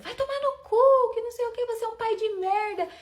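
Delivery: grito agudo e furioso, bem mais alto; gritando com raiva. Retold or imitated. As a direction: berrando xingamentos ao sair da casa. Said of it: "Eu saí da casa dele gritando". (grito de raiva contra o pai)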